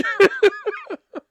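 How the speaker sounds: big high-pitched burst of laughter; real cracking-up laugh; loud high laugh. Real, not acted. Laughing at own prank.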